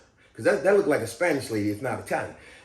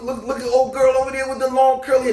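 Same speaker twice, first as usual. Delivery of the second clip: playful hollering; 6 semitones higher, 4 dB louder than usual. Retold or imitated. Clowning patient calls out to a laughing lady, playful hollering. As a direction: holler a teasing threat, pitched up.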